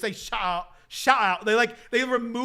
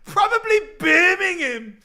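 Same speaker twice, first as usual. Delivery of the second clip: shrieky-high, loud mock British accent; imitated Birmingham-video British accent; 11 semitones higher, 8 dB louder than usual. Quoting a favorite accent video to wife, imitated British accent. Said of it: "That's what I say to my wife all the time".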